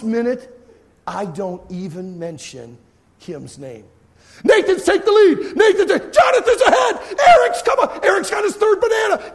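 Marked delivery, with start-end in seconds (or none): 4.4-9.3 high, loud, laughing commentary; breathless contest announcer shouting the lead; excited play-by-play. Retold or imitated. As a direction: call the race like a sportscaster.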